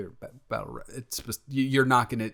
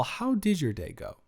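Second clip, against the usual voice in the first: ordinary talk, nothing marked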